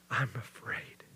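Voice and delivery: unvoiced, hushed "I'm afraid"; whispered fear. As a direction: breathe the confession out in a whisper. Disciple confessing fear.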